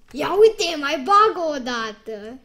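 loud, eager look-at-this; loud excitement. Real, not acted. A player loudly urging a big card to drop again. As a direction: call out excitedly.

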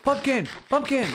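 loud, high yell of delight; excited shout. Real, not acted. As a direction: shout it out, thrilled at the find.

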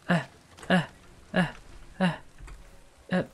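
ordinary talk, nothing marked

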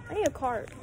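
ordinary delivery

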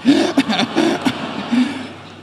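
a real breathy laugh; wheezy chuckle after the joke; airy laugh. Real, not acted. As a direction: laugh at your own punchline. Laughs at his own punchline.

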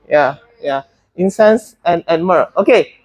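normal talking voice

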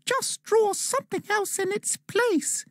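high elf voice, gentle suggestion; suggesting. A narrator's reading. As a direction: elf advising Dan about his drawing. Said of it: "the elf suggested".

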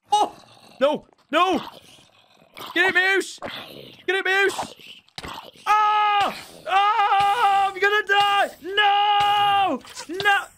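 high, loud terrified shrieks; panicked screaming. Real, not acted. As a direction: shriek like you're about to die. Dying in game, screaming.

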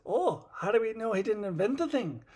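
a hint of Scotty wondering aloud; mild Scotty voice. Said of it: "And Scotty says".

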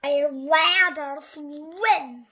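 slow, loud frog voice; lightly voiced storybook frog; croaking frog. A narrator's reading. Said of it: "the frog croaked".

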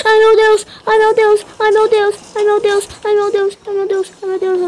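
loud high screams of panic, easing a little; panicked screaming. Real, not acted. Gamer fleeing the monster, screaming in panic. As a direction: scream it over and over as you run.

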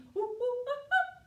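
high hooting monkey laugh; a silly high monkey giggle. Pretend. Teacher to kids, playing the monkey, high giggle.

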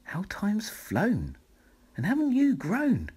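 the flock welcoming the snail home; flock's greeting. A narrator's reading. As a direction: coo over how they've grown. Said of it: "who said".

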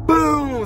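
high boom sliding down; imitated boom. Telling how the same thing happened again, high falling boom. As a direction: punctuate the story with a falling boom.

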